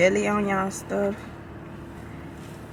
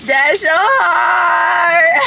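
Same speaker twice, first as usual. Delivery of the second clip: drawn-out shout; 10 semitones higher, 8 dB louder, much slower than usual. Real, not acted. Teasing partner, loud drawn out.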